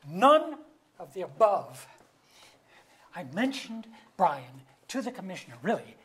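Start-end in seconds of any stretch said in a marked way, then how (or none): 0.1-1.9 insist, louder than the rest; indignant denial. Acted. Denying Julia's accusation to her face, indignant and loud.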